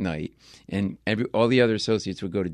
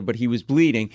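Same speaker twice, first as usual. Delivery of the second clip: ordinary talk, nothing marked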